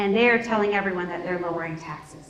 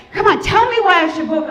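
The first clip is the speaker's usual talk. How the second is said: pushy retold demand, higher and louder; aggressive demand; 8 semitones higher, 7 dB louder than usual. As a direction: push hard like a fed-up voter. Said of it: "like, aggressive kind of way".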